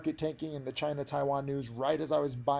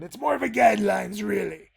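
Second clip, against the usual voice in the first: Geoffrey Rush; 7 semitones higher than usual. Acted. Trader quoting Pirates of the Caribbean on guidelines, in a hammed-up pirate accent. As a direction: put on a theatrical pirate accent. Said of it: "really hard to do that accent".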